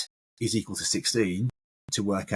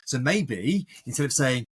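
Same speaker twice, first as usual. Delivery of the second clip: ordinary talk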